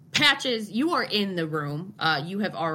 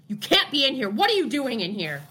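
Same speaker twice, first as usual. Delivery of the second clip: angry; 7 semitones higher than usual. Acted. GM voices NPC confronting.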